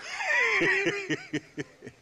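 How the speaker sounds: loud, high barking laugh; big laugh. Acted. Old gunman laughing hard at a friend's bad luck, loud barking laugh. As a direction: throw your head back and bark out a laugh.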